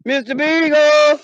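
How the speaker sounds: high, slow, drawn-out call. Pretend. Owner searching for lost dog, long high call. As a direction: call slowly into the distance, holding the end.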